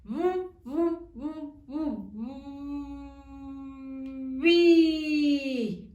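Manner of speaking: chanting "bu" to build "bi"; sounding out. Shown as a demonstration. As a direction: fade the "bu" pulses, then pop "bi".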